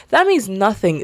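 ordinary talk, nothing marked

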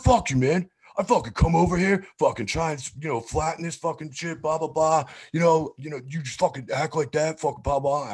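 put-on high-pitched drunken rant; drunk yelling. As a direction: imitate a drunk guy losing it. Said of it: "just lost it".

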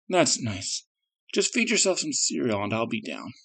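soft, drowsy brush-off; sleepy mumble. Acted. Sleepy mother brushing off child.

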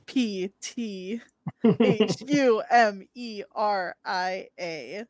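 spelling it out. Shown as a demonstration. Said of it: "at Thumeria".

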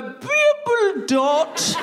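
drawn-out, high nonsense word; a playful invented word, stretched out; silly made-up word. Shown as a demonstration. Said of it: "you go".

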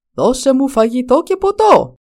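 loud, high insistent dragon demand. A narrator's reading. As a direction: insist loudly as a hungry dragon. Dragon demands food a second time.

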